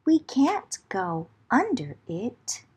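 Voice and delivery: usual speaking voice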